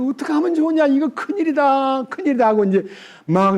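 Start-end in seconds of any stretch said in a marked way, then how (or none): 0.0-2.5 mock wailing. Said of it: "막 대성통곡을 하면서".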